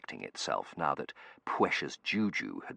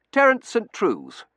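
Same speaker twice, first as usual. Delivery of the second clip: put-on young man voice; 11 dB louder, slower than usual. Terence character voice.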